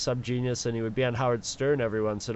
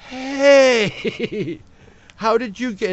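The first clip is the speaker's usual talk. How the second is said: loud, high, long-drawn hey; mock amazement; 10 semitones higher, 8 dB louder, much slower than usual. Listeners discovering the DJ's Stimpy promo, mock amazement. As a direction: call out as if suddenly impressed. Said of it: "they were just like".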